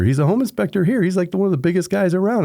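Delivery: high and quick, eager to tell; eager explaining. Retold or imitated. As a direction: rattle it off excitedly. Bingo lady explaining.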